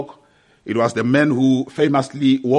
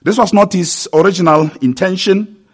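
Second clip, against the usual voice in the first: raised, earnest pulpit delivery; preaching; 6 semitones higher, 7 dB louder than usual. Real, not acted. Preacher exhorts brothers and sisters to accept scripture, earnest preaching. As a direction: press the point on the congregation.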